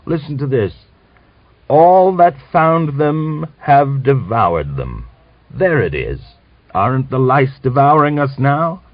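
put-on old man scolding Grigor as a troubler; harangue. A narrator's reading. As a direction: hammer home the prophecy.

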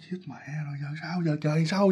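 hushed, panicky whispered thought; very soft whispered dread; scared whisper. Retold or imitated. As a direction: whisper it as if afraid to be found. Her frightened silent thought while hiding.

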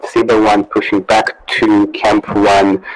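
loud radio transmission; crackly military radio. Real, not acted. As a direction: voice over a field radio. Radio call from another unit.

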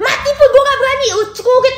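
high, fast mock outburst; high-pitched hammed-up ranting; imitated angry rant. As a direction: parody an angry auntie in a rushed high voice. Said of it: "Tadi aja marah-marah".

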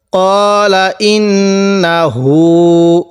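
slow Quran reading; slow recitation. Shown as a demonstration. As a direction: recite slowly, letting হা sound light in the mouth. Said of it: "মুখে হালকা আওয়াজ করব".

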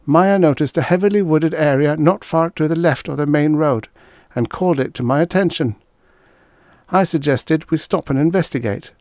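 put-on nervous young lieutenant. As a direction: recite the testimony under strain.

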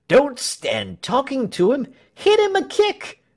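very high-pitched heckler from the crowd; excited squealed urging; shouted encouragement. A narrator's reading. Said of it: "Shouts of encouragement from the crowd".

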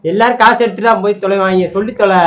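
loud, impatient retort; exasperated outburst. Real, not acted. Husband fed up with wife's repeated story, exasperated. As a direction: blurt it out, fed up.

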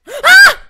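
sudden piercing high scream; startled scream. Real, not acted. Gamer jumping at a snake attack with a loud scream. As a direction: shriek as the snake strikes.

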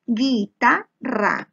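"Guitarra" split slowly into syllables; slow teacher's syllabification of "Guitarra". Shown as a demonstration. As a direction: draw out each syllable of "Guitarra", with breaks between. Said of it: "Separamos en sílabas".

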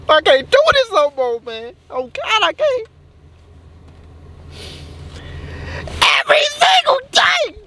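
high-pitched, whiny despair; dramatic meltdown. Acted. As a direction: build to a squealed breaking point. Acted meltdown over bad day.

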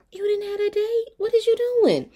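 high-pitched mock mom on the phone; disbelieving high voice; incredulous mother. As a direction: question him in a high, put-on mother's voice. Mom answers him on phone.

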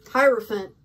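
usual speaking voice

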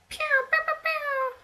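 very high, loud pew pew; gun sounds. Retold or imitated. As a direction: blast out mock laser shots. Imitating video game gunfire.

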